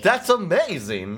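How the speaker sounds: high, loud, drawn-out fake amazement; put-on thrilled cheer; insincere enthusiasm. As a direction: overdo the gushing praise. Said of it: "now you sound insincere".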